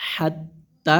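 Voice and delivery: "হাত্তা" with the final "তা" held long; madd elongation. Shown as a demonstration. Teacher demonstrates the pulled reading, "হাত্তা" drawn out at the end. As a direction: stretch the last vowel of "হাত্তা" in a level chant. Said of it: "এক আলিফ টেনে পড়তে হয়".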